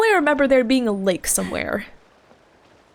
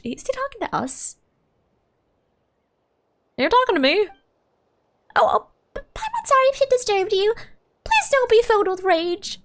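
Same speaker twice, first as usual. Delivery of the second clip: high-pitched, timid little companion; Paimon, flustered; 9 semitones higher than usual. Acted. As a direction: stammer an apology in a squeaky character voice. Voices anxious game companion.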